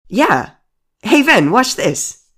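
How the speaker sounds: loud, high, thrilled cheer; excited calling out. Acted. Calling the doll to watch hand-holding, excited shout. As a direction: call out loud and high, showing off.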